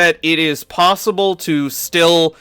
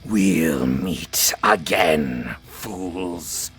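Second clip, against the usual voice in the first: deep, slow lich voice; menacing undead king; ancient rasping undead king; 10 semitones lower, 6 dB quieter, much slower than usual. Acted. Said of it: "says the Lich".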